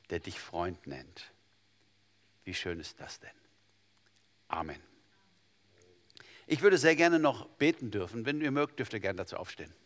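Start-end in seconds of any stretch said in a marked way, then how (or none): 0.1-4.8 tender hush. Real, not acted. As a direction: let it sink in gently. Tender close of the sermon.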